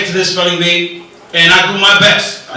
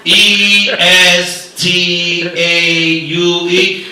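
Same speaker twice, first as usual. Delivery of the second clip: slow spelling; 2 semitones lower, much slower than usual. Retold or imitated. Kid spells with accent.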